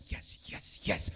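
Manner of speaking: secretly thrilled. Acted. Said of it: "if you're a prophet, you're like".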